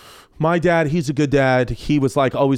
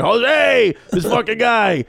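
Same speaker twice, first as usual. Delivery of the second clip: high, hearty call imitating dad; boisterous teasing dad; 9 semitones higher, much slower than usual. Dad teasing his house guest, imitated boisterous call. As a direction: holler it like a joking old-school dad. Acting out dad's loud teasing.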